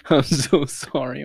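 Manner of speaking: slow, spoken aloud through laughter; laughing sorry. Real, not acted. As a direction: apologize out loud while cracking up. Laughing apology after a fart.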